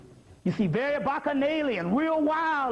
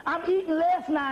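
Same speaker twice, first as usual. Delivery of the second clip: ordinary talk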